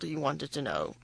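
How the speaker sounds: ordinary delivery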